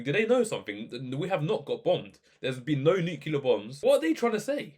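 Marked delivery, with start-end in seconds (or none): 3.8-4.7 incredulous, very high-pitched question; exasperated disbelief. Real, not acted. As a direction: voice leaps up in bafflement. Exasperated reaction to doom predictions.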